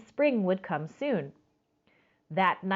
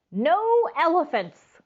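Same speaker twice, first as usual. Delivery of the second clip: firm refusal; 9 semitones higher, 5 dB louder than usual. A narrator's reading. Grandma refuses.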